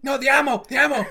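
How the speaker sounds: very high, very loud frantic yell; hammed-up frantic squawk; mock panicked shout. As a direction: shout a correction in playful gaming panic. Re-enacting panicked shouts while gaming.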